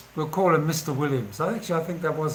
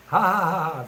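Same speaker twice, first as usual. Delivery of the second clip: higher, louder mock "ha ha ha"; imitated laughter; 4 semitones higher, 4 dB louder, faster than usual. Kids laughing at his Donald Duck joke, imitated laugh. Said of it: "they laugh".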